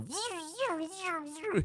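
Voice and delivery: high whining drone noise; drone whine imitation. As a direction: mimic a drone melting mid-flight. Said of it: "you hear it go".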